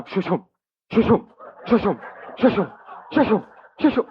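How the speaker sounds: rhythmic imitated tape flapping, rising a little; playful mock tape sound; broken tape noise. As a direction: mimic a snapped reel spinning, laughing through it. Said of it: "it just started going".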